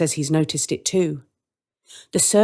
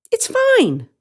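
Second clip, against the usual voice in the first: much higher, sing-song reassurance; the woman's voice; 5 dB louder than usual. Acted. The woman welcoming the children, high and sweet. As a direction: brush it off sweetly. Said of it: "says the woman".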